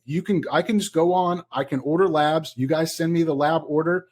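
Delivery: ordinary delivery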